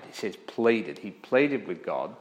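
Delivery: ordinary talk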